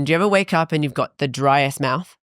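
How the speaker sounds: ordinary delivery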